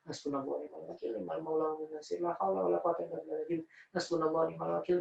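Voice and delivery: soft, even devotional recitation; murmured dhikr. Retold or imitated. Worried son doing dhikr in the waiting room, soft chant. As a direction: repeat the prayer quietly, as if to yourself. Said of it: "Sambil itu dikir".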